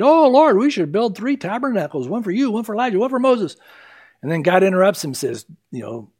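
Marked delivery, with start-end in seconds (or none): 0.0-3.6 higher-pitched, eager mock gushing; excited Peter. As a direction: blurt out a suggestion, imitating an overexcited disciple. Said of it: "Peter's all excited".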